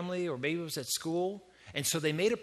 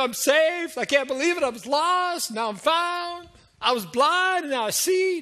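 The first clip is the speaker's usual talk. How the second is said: high, loud burst of amazed excitement; hammed-up overjoyed convert, pitched way up; gushing new convert; 9 semitones higher, 5 dB louder than usual. New believer telling of being saved, hammed-up excitement. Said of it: "maybe they don't talk like that".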